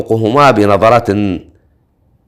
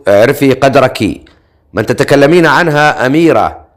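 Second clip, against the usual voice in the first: ordinary delivery, nothing marked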